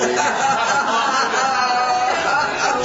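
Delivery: loud, high burst of laughter; hearty laughter. Real, not acted. Preacher laughing at his tidy-up joke, loud.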